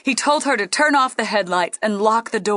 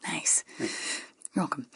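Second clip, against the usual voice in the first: whispering; 5 semitones lower, 11 dB quieter, slower than usual. Real, not acted. Cheeky aside in whisper.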